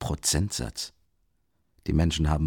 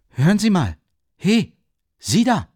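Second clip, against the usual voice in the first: loud, high-pitched shout after someone; calling after someone; 10 semitones higher, 7 dB louder than usual. A narrator's reading. Raskolnikow calls after the departing policeman, shouting. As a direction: shout to catch the policeman's attention. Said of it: "rief er dem Schutzmann nach".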